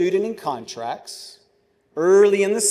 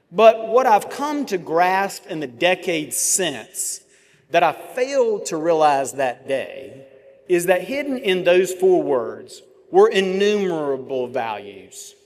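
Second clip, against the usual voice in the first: normal talking voice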